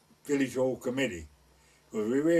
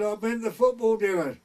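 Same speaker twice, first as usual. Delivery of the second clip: put-on higher, louder drunk reply; tipsy shout; 8 semitones higher, 6 dB louder than usual. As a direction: call it up, tipsy. Drunk lad answering up.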